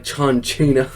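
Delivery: mangle "John Cena" into "Jont Shina" the Santino way; Santino's mispronunciation. Retold or imitated. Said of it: "mispronounce everyone's names".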